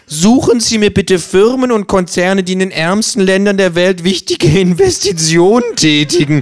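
höher, salbungsvoller Werbespot-Tonfall; Werbesprech. Acted. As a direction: lies es wie einen schmalzigen Bankwerbespot. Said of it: "wie in Ihrer Werbung formulieren". (Känguru parodiert die Bankwerbung im Werbeton)

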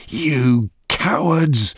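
slow, high, furious scream; angry scream. A narrator's reading. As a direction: scream the accusation, stretching each word out. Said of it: "she screamed".

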